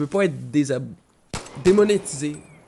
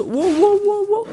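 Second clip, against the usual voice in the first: very high, loud repeated yelps; panicked yell; 8 dB louder, slower than usual. Real, not acted. Player under renewed attack, panicked yelling. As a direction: yelp in alarm, climbing higher.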